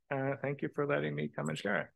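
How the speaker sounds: normal talking voice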